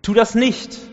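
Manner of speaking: high, loud retold shout. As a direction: yell it to stop the jailer. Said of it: "Und dann Hals durchs Gefängnis". (lauter nacherzählter Ruf)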